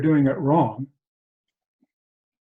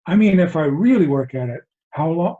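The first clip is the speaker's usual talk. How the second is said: louder, insistent mock protest; shocked student; 3 semitones higher, 5 dB louder than usual. Shocked student asking his Zen master, mildly insistent. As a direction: press the point as the taken-aback student. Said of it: "Student is shocked by this".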